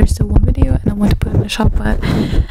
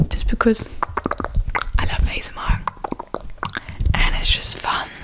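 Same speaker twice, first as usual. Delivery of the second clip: whispering; 7 dB quieter than usual. Acted. Whispering about ASMR.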